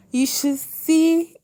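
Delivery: high, loud, slow burst of joy; thrilled exclamation; excited. Real, not acted. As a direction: gush about it. Recalling family's joy.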